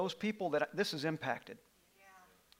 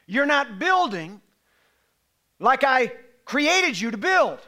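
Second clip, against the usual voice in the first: loud, higher, fervent sermon; full-force preaching; 7 semitones higher, 9 dB louder than usual. Acted. Pastor stirring up his congregation, preaching loudly. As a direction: hammer the message home.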